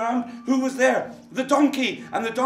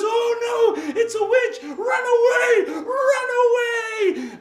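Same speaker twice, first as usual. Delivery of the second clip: very high, loud wailing shout; panicked scream; 7 dB louder, slower than usual. Acted. The pecked thief screams high and loud that it's a witch. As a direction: scream and bolt in terror. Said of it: "And he goes".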